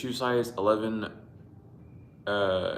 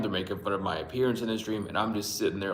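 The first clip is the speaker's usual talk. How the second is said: usual speaking voice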